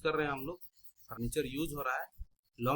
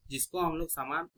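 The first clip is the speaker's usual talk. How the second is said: ordinary talk, nothing marked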